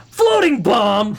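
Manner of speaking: slow drawn-out shout, high. Pretend. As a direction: belt it out like a cartoon reveal. Sudden gag shout mid sentence.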